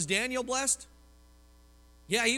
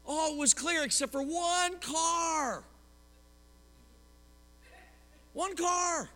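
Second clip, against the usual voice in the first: drawn-out, raised exasperation at the one car; exasperated; 7 semitones higher, slower than usual. Retold or imitated. Trucker stuck at a turn, exasperated. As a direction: stretch it out like you can't believe it.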